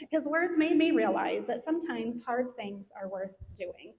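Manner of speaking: ordinary talk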